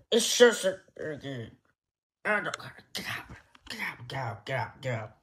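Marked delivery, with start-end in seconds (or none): 2.9-5.1 fast unvoiced whisper; rapid whispered get out; urgent whisper. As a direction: hiss it urgently.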